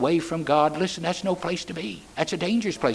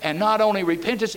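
usual speaking voice

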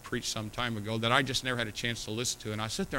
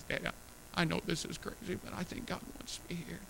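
hushed, higher, nearly whispered; tearful whisper; 4 semitones higher, 10 dB quieter than usual. Retold or imitated. Daughter tells dad softly of God's call. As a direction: breathe it like a girl moved to tears. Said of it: "tears in her eyes".

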